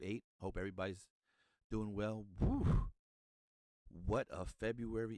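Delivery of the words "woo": high, loud cheer; excited whoop. Real, not acted. Excited cheer after greeting.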